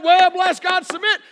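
hammed-up shout of a man laying down the law; mock macho demand. Men demanding submission.

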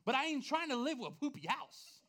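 high, loud mock panic; freaking out. Homeowner facing an eight thousand dollar bill, acting out freaking out. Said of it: "I was started freaking out".

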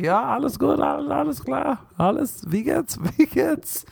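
high, panicky fake German; mock nervous German; flustered high German.

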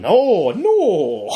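high, drawn-out "no"; hammed-up wail of refusal; mock dismayed cry. Retelling a character's horrified refusal.

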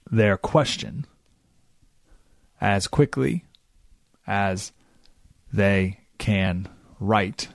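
extremely slow, with long pauses between words; dictation-slow speech. Shown as a demonstration. Radio host answering a reporter so they can write it down, very slowly. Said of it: "I'll talk like this so that they can write down".

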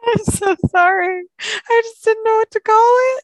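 high, giggly apology; laughing apology. Real, not acted.

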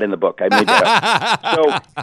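laughing through the words, slowing down. Real, not acted. Author admits a made-up joke to the host, laughing. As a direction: laugh at your own joke.

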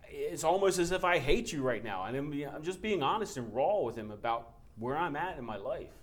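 ordinary talk

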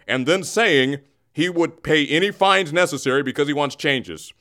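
formal anchorman delivery, pompous and measured; news anchor parody. Acted. Bagman presenting his mock news report to listeners. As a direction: read it like a self-important evening news anchor.

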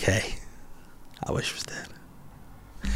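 very soft, hushed confession; mock despairing whisper. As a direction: whisper it slowly, as a joke. Repeats the joke death wish.

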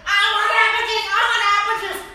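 very high, loud mock whine; scream-whine like a three-year-old; hammed-up child tantrum; toddler tantrum. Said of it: "A three-year-old in a cafe saying".

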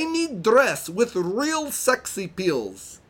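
high, slow mock Borat accent; hammy Italian-Borat accent. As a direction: put on a silly Italian accent sliding into Borat. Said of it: "I'm gonna read it in the best accent that I can".

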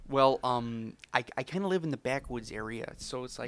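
put-on friendly neighbour with a mild Canadian accent. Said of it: "You sound like you're from Canada".

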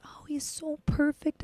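breathless, slow lovestruck whisper; dreamy whisper. Retold or imitated. Whispering to friends about the perfect guy, dreamy and slow. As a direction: sigh it out like you're smitten. Swooning over a guy at party.

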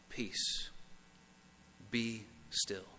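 soft, breathy whisper of peace. Pretend. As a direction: hush it like calming a storm. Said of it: "God says to you and to your heart".

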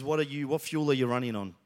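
ordinary talk, nothing marked

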